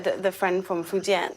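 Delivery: ordinary delivery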